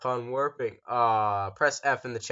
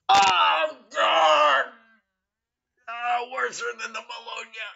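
loud, high disgusted yell; disgusted shout; 8 semitones higher, 7 dB louder, slower than usual. Real, not acted. Disgusted reaction to box.

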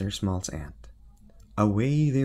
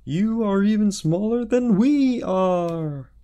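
high, loud ha ha ha, then slow scorn; king laughing at the small knight; loud, high mocking king laughter; 13 semitones higher, 9 dB louder, slower than usual. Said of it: "The king laughed".

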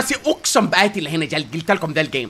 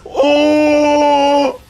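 long drawn-out high wail of panic; real panic in the storm; panicked wail; 6 semitones higher, 4 dB louder, much slower than usual. Real, not acted. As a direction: stretch the oh in terror. Panicked as zone closes.

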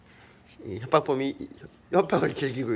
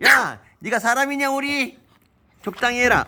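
scolding; 10 semitones higher, 3 dB louder than usual. Real, not acted. Scolding the dog after bite.

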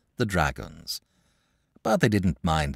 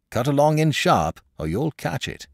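louder, higher warning; quick, emphatic send-off; brisk dismissal; 5 semitones higher, 3 dB louder than usual. A narrator's reading. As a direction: hurry the children indoors. Man sends children indoors with warning.

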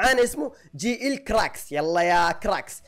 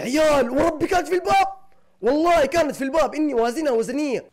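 excited; 7 semitones higher, 4 dB louder than usual. Real, not acted. Near miss, hit the post.

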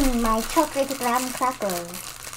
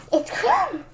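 gasping fan; 12 semitones higher than usual. Acted. Fan gasps at the villain.

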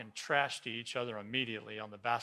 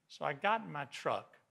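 ordinary delivery

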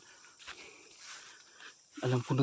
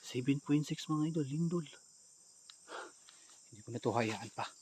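normal talking voice

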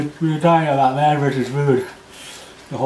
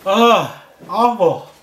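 strained, higher pained talk; mouth on fire; 5 semitones higher, slower than usual. Real, not acted. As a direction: talk through a burning mouth. Dad after hot peppers.

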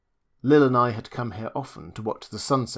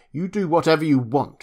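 higher and louder, snapping it out before storming off; angry retort; 6 semitones higher, 7 dB louder than usual. Acted. Rita to Lil before storming out, angry. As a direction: fling it at her and leave. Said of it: "she said, and stormed out of the room".

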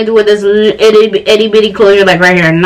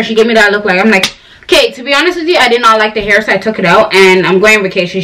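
ordinary talk, nothing marked

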